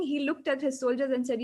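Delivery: usual speaking voice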